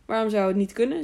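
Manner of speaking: ordinary delivery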